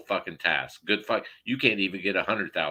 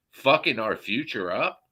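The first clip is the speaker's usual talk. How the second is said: usual speaking voice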